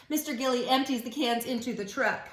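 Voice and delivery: normal talking voice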